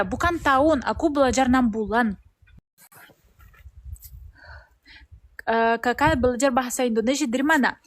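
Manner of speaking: Russian accent in Indonesian; learner's Russian-accented Indonesian; foreigner speaking indonesian. Real, not acted.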